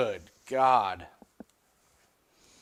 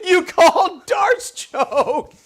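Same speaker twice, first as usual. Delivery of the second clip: much higher, louder, stretched-out scream; ecstatic shout; 11 dB louder than usual. Real, not acted. Watcher to Jim who called the choke, ecstatic screaming. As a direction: draw it out in a disbelieving victory scream.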